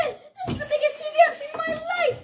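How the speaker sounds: high crying-out amazement; excited shout. Acted. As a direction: shout it like you're overwhelmed. Retake with big excitement.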